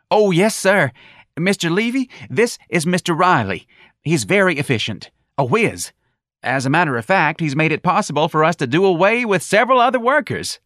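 high, eager, loud bootlicking; fawning high voice. A narrator's reading. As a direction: gush to impress the boss. Gonzalez fawning on the boss.

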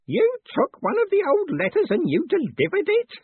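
put-on very high, loud, incredulous old man; utter disbelief. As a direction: say it as if it cannot be grasped. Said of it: "as if the concept was something he could not fit".

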